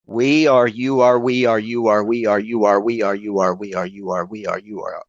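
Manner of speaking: even, looping chant that sinks and fades; repeated mantra. Retold or imitated. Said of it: "a mantra of sound over and over".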